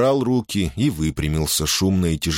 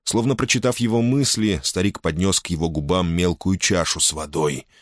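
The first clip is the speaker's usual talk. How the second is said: ordinary delivery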